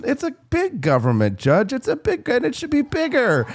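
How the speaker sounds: lightly imitated politician's voice, rising high; a hint of his distinctive voice; Charlie Rangel. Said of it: "because he had that voice".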